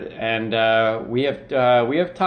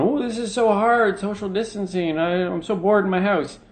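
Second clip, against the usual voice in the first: higher, imitating bored complainers; mock whining; 7 semitones higher than usual. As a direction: whine about quarantine in mock self-pity. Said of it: "everyone who's complaining about going".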